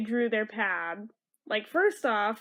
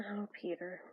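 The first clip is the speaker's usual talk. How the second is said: whisper; 5 semitones lower, 14 dB quieter than usual. Real, not acted. Fondness for hippie Peter.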